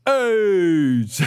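high, loud shouted AIDS over the PA; yelling into the intercom. Retold or imitated. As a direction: bellow it like a stadium announcement. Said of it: "presses it, and goes".

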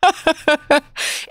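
laughing at herself. Real, not acted. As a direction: laugh off the fuss. Laughs at own disgust.

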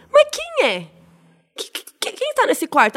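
a stuttering, baffled clerk; confused receptionist. Retold or imitated. The receptionist taken aback by her complaint, high flustered stammer.

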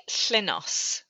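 Llynnos with a Welsh Ll; Welsh pronunciation. Shown as a demonstration. Author shows the host how Llynnos is pronounced, careful Welsh sounds. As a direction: say Llynnos the Welsh way, hissed Ll at the start. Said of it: "How do you pronounce that?".

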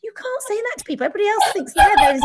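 high, fast mock exasperated husband. Husband Graham scolding her baby boasting, mock exasperated. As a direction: rattle it off like a flustered husband. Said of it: "And Graham's like".

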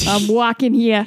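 laughing New York tough-guy line; laughing mock New York accent. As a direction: toss it off like a New Yorker through a laugh. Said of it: "try to do an accent".

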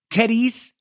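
Teddy's said the Spanish way, rolled and rounded in a Latin accent. Shown as a demonstration. Podcast host names his taco spot, mock Latin accent. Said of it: "That's my, uh, Latin accent".

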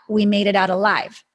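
usual speaking voice

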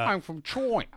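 mock voice. Joke put-on voice.

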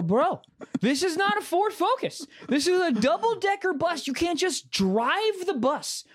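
high-pitched, slowed mock outrage at a bold claim; incredulous disbelief. Telling the driver he can't just drive the bus, incredulous and high. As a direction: stretch it out like you can't believe him.

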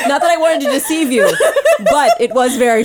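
gleeful and laughing. Real, not acted. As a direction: giggle through it, delighted. Gleeful reveal that both guessed wrong.

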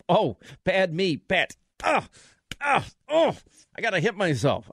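hammed-up self-punishment with grunts; mock self-scolding. Host hitting himself over a tiny Twitter mistake, mock self-scolding.

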